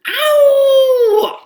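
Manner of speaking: very high, loud, drawn-out "ow"; crying scream of pain. A narrator's reading. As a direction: wail like a child getting a shot. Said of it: "I scream and cry".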